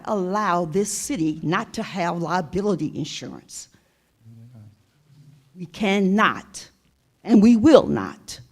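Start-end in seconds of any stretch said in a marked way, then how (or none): 5.5-8.4 rising to a loud, firm vow; emphatic declaration. Real, not acted. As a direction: build to a forceful finish. Emphatic vow ending speech.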